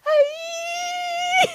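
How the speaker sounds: high squealing cry of fright; imitating a scared lady on a coaster; mock scared cry. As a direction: squeal like a terrified rider. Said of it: "She's all".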